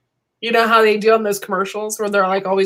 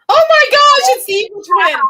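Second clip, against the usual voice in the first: surprised shriek; 7 dB louder than usual. Real, not acted. Startled by the evil twin.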